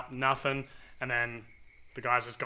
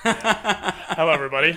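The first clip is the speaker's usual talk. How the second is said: laughing greeting; 5 semitones higher, 10 dB louder than usual. Real, not acted. Guest's loud laughing entrance.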